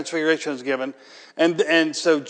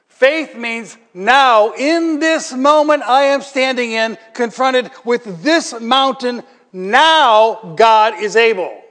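louder, higher, slow and pounding on now; preaching at full force; 6 semitones higher, 6 dB louder, slower than usual. Acted. Preacher urges the church about faith in the moment, preaching hard. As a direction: preach it out, hammering each now.

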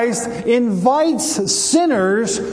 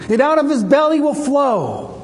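raised, higher-pitched proclamation; mild preacher's cry; crying out loudly; 4 semitones higher than usual. Retold or imitated. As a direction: imitate Jesus calling out to a crowd. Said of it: "He said it loudly".

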